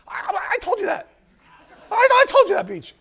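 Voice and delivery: very high, loud, laughing protest; indignant squeal. Retold or imitated. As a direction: squeal that you already told him. Andrea claiming the beach story.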